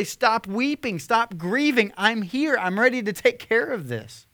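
a preacher voicing Jesus taking charge; commanding Jesus. Jesus tells the mourners to stop weeping, voiced high and commanding.

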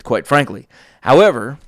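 ordinary talk

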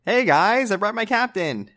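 high, bright friendly greeting; cheerful character voice. Acted. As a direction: call out to them in a chirpy voice. Player voices character greeting band.

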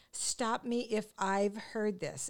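drawn-out, deliberate title reading; slow and careful. Retold or imitated. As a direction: spell out the title slowly, word by word. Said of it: "doesn't matter how slow you say it".

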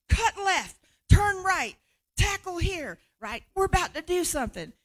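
loud, high coach calling plays; football play call. Retold or imitated. Angels calling God's plan like a loud coach. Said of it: "like a football play".

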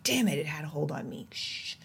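hissed under the breath; annoyed whisper at her own habit; whispered curse. Retold or imitated. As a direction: spit it out in a whisper. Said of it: "when I'm like".

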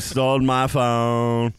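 slow, stretched simpleton drawl; Forrest Gump. Retold or imitated. Coworker finishes customer's tale, slow mock drawl. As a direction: drag out each word in deadpan mimicry. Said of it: "guy who talked like Forrest Gump".

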